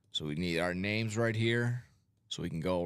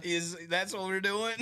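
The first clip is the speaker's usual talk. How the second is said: mock incredulous host; 9 semitones higher than usual. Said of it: "He'll just go".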